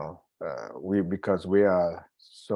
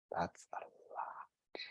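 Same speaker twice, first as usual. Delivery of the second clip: slow, near-whispered aside; hushed aside; 16 dB quieter, much slower than usual. Real, not acted. Teacher marvels at a practitioner with seven children, hushed aside. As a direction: drop to a slow whisper, letting it sink in.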